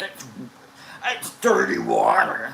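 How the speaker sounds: loud, slow angry imitation; mock mad. Storyteller imitates Kevin mad at the water, exaggerated anger. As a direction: imitate an angry friend grousing loudly and slowly. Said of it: "Kevin's all mad at the water".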